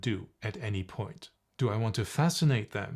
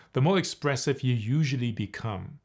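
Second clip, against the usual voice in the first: ordinary talk, nothing marked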